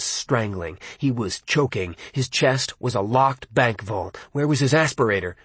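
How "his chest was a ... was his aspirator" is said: plain reading voice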